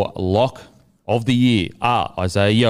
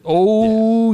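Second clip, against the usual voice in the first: knowing oh; 12 semitones higher, 5 dB louder than usual. Acted. Mock realisation about inflicting.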